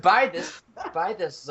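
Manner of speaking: high-pitched put-on New York accent; mock New York accent. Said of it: "New York, uh, accent".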